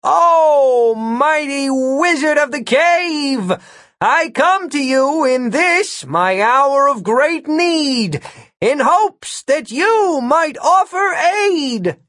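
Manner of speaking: high, slow booming bellow. Acted. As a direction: call out grandly to the cave, drawn out. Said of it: "and bellowed".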